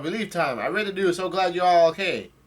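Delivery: high, loud yell voicing a character; hammed-up yell of relief; retold joyful yell. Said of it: "Brook yells out".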